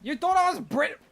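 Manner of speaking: high, loud, put-on British accent; mock British disbelief; fake British accent. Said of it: "fake British accent".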